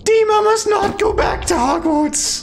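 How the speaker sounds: high squeaky house-elf voice, slow; Dobby voice. Pretend.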